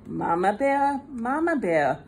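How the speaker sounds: usual speaking voice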